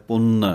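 ਪੁੰਨ nasalised with a heavy tippi; the mistaken ਪੁੰਨ with a strong nasal; ਪੁੰਨ with an added nasal hum; wrong, with tippi. Shown as a demonstration. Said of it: "ਜੇ ਟਿੱਪੀ ਲਾ ਕੇ ਬੋਲਾਂਗੇ".

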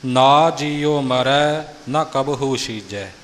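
ਨਾ in ਨਾ ਜੀਓ long and loud, ਨਾ in ਨਾ ਕਬਹੂ short, very slowly; slow deliberate model reading of ਨਾ ਜੀਓ ਮਰੈ and ਨਾ ਕਬਹੂ ਛੀਜੈ; pronunciation teacher spelling out ਨਾ sounds; careful model recitation. Shown as a demonstration. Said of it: "ਸੋ ਇਹ ਉਚਾਰਨ ਦਾ ਖਿਆਲ ਰੱਖਣਾ".

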